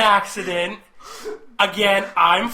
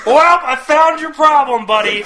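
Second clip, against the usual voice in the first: 1 folksy fire policeman; 7 semitones higher, 7 dB louder than usual. Retold or imitated. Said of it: "He goes".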